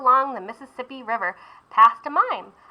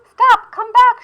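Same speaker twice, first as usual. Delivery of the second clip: much higher, much louder call; shouting; 13 semitones higher, 12 dB louder, slower than usual. Acted. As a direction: holler after him. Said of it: "shouted the jazz players".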